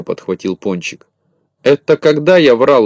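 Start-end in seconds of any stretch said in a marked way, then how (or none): 1.6-2.8 high, loud indignant question; raised, incredulous query; surprised. A narrator's reading. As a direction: protest in astonishment. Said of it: "удивился Незнайка".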